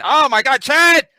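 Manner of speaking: Oh my God and the name burst out high and loud; excited outburst. Real, not acted. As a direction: yell his name like a horror-movie victim. Mock horror at Chad's switch.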